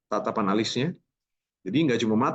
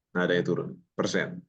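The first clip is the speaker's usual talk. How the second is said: ordinary delivery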